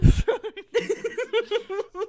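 high, loud burst of laughter. Real, not acted. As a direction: crack up loudly. Big laugh after the lyric.

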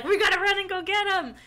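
high, loud eager call; excited. Retold or imitated. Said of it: "she's like".

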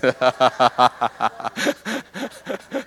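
long chuckle at himself; laughing. Real, not acted. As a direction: laugh off your own bad impression. Laughs at own bad accent.

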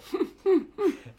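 sharp high burst of laughter; loud high laugh. Real, not acted. Laughing at story.

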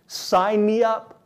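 eager. Pretend. Eager listener voiced.

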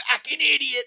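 mock insult. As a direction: spit it out like an angry cyclist. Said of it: "He's like".

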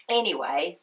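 hoarse, croaky speaking voice. Real, not acted. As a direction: talk through a croaky throat. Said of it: "my croaky voice".

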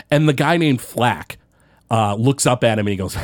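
usual speaking voice

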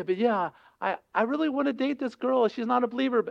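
high-pitched, wheedling objection; mock pleading, high voice; pleading excuse. Voicing someone pleading with God.

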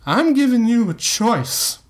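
high, slurred bravado; put-on confidence, pitched high; slurred faux confidence. A narrator's reading. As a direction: slur it out with fake confidence. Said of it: "he slurred with faux confidence".

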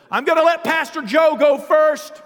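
high-pitched shouted announcement; loud, angry lecturing. Retold or imitated. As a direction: order the room about at full voice. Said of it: "That's how he was talking to him".

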